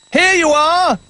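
high shout up to Jolly. A narrator's reading. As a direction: throw the words down with the rope. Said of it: "he shouted".

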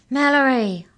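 high, loud cry of the name. A narrator's reading. Arthur crying out to trapped Mallory, loud and high. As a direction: call her name in alarm. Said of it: "cried Arthur".

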